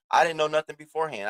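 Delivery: usual speaking voice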